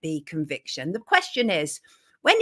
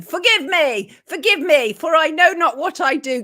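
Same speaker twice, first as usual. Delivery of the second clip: louder, higher theatrical begging for pardon; mock-dramatic plea; 6 semitones higher, 6 dB louder than usual. Host apologizing to viewers for her tech blunder, mock-dramatic. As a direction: plead grandly, hamming up the apology.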